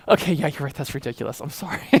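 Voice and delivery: low voice fading to very soft; sheepish apology. Acted. Preacher acting out giving in to God, sheepish and hushed. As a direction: back down quietly with a touch of laughter.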